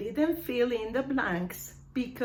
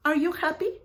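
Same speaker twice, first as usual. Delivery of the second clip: mock yes-no question; 7 semitones higher, 4 dB louder, much faster than usual. Shown as a demonstration. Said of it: "Don't say".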